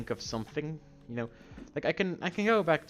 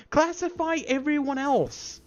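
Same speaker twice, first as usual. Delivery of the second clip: high, heated tirade; worked-up ranting at raised pitch; angry rant; 7 semitones higher than usual. Real, not acted. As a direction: lash out in outraged indignation. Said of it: "I did not expect to get this angry".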